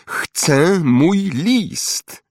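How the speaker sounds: very slow, high, drawn-out demand; shout. A narrator's reading. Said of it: "krzyknął". (przeciągły, wysoki okrzyk żądania)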